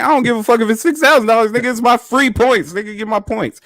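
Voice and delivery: high, loud indignant rant; retold angry outburst. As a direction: tell off the cashier, fed up. Arguing with cashier over points.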